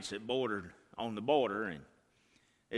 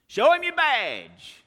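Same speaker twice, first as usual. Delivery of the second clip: high, loud, slow shout; 12 dB louder, slower than usual. Retold or imitated. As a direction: burst out with it. Same line then shouted.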